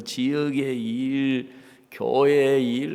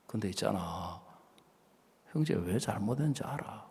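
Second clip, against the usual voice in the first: normal talking voice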